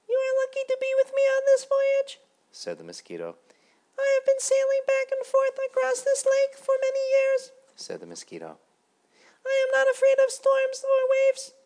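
very high, loud squeaky little mosquito; a tiny self-important ferryman insisting on rules. A narrator's reading. Mosquito ferryman lecturing Grasshopper, very high and loud. Mosquito boasts while sailing.

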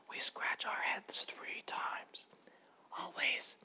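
hushed, breathy little butterfly voice; whispering butterfly. Acted.

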